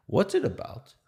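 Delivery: put-on high, bright Yiddish-accented question; a put-on Yiddish accent, asked curiously. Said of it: "I'm gonna do a Yiddish accent".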